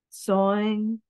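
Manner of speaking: usual speaking voice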